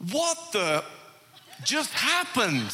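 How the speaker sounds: high-pitched, drawn-out comic astonishment; stunned disbelief. Retold or imitated. Checkout lady reacting to the gift, astonished. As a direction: imitate a gobsmacked bystander, slowly.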